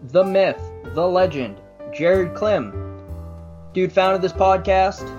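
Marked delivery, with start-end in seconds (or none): none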